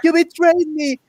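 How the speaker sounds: very high, strained mock scream; hammed-up wailing scream in imitation. Retelling a betrayed man in the forest, mock screaming. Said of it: "is screaming and crying in the forest".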